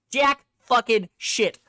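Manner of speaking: slow, loud, each word hammered; emphatic outburst. Real, not acted. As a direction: spit it out word by word in disgust. Angry rant about missing figures.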